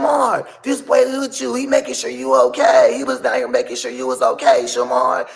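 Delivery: high, loud mimicry of a pleading woman; shrieky imitated scolding; upset woman. Voicing the lady pleading with Shamar.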